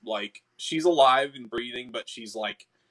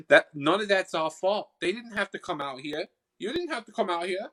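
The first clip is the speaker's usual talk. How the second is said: high Boston sprite shrugging off blame; defensive Boston-accented sprite; 6 semitones higher than usual. Acted. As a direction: protest innocence in a raised Boston voice. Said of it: "I'm stuck in the Boston accent".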